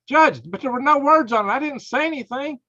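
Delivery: high, desperate pleading to the judge. Pretend.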